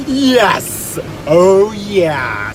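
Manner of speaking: loud, high victory shout; exultant, full-volume cheer; triumphant celebration. Real, not acted. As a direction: yell it out like you just won. Celebrates getting the listing.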